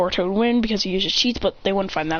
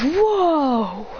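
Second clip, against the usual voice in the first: surprised; 4 semitones higher than usual. Acted. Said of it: "He goes".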